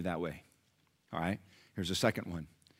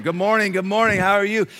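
higher, sing-out hello to a room; cheery greeting; 10 semitones higher, 9 dB louder than usual. Retold or imitated. Changed Nelson greeting fellow patients, cheery. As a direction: greet everyone brightly, voice lifted.

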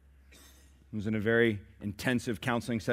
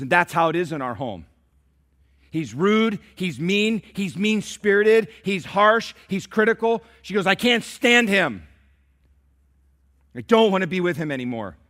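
higher and louder, building grievance; indignant complaint; 7 semitones higher, 8 dB louder than usual. Retold or imitated. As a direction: vent like the fed-up wife. Wife venting about husband.

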